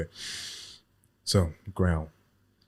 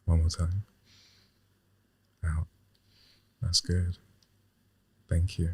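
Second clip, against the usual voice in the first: guided breathing voice; 4 semitones lower, 4 dB quieter than usual. Pretend. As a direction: lead the breath gently.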